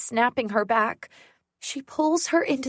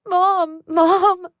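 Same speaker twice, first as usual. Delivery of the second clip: high, loud desperate scream; screaming for Mom; 8 semitones higher, 8 dB louder than usual. Acted. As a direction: scream for your mother with all you have. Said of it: "I scream".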